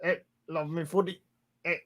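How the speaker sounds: soft, put-on British-Australian accent; muttered fake British-Australian accent; mock accent. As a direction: quietly ham up a footy fan's accent. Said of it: "that's more an Australian thing".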